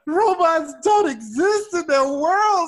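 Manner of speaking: hammed-up cracking up; hysterical amusement. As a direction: squeal it out, barely able to explain. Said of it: "I can't stop laughing".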